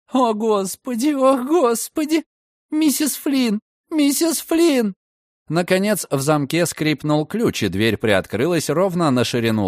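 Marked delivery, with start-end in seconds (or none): 0.1-4.9 very high, slow panicked cries; panicked calling. A narrator's reading. Frightened woman calling for Mrs Flynn, high panicked wail.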